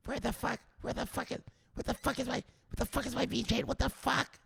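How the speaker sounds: very high, soft squeaky panic; a friend mimicking his panic, high and thin; mocking squeaky voice. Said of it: "And then he said".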